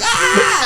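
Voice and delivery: very high mock squeal of glee; giddy squeal. Happy customer grabbing her stuff, giddy squeal. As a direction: imitate an overexcited shriek without much volume.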